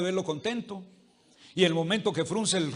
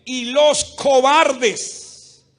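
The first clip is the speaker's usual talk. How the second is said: slow, loud and higher, each word drawn out; "Y los cobardes" stretched slowly, much louder and higher; dramatic scripture quote; 5 semitones higher, 9 dB louder, much slower than usual. Real, not acted. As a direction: proclaim it like a verdict from the pulpit.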